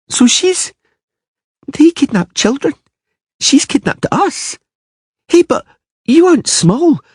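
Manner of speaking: put-on high, fretful little girl; a girl spooked by tales; anxious child. A narrator's reading. Ciri alarmed.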